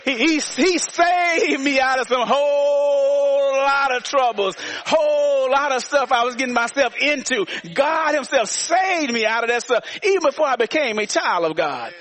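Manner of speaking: impassioned, high-pitched preaching; full-force preaching. Real, not acted. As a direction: testify from the pulpit, stretching key words. Preacher builds to a peak.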